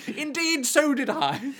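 high-pitched pompous reply; put-on high voice, pompous; odd one out. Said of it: "That one guy was like".

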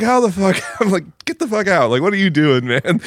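high, exasperated scolding of a friend. Retold or imitated. Bouncer to friend Charlie, high exasperated disbelief.

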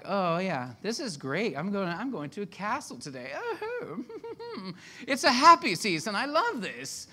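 hammed-up delight, rising high with a giddy laugh; gushing happiness. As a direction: gush like a thrilled tourist, overdone. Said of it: "that was a lot happier season".

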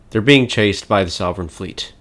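normal talking voice

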